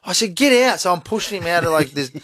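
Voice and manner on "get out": exasperated order. Retold or imitated. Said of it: "he's screaming, bawling, and I'm like".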